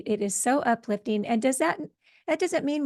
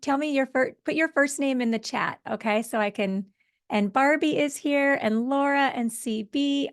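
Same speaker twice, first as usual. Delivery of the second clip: ordinary talk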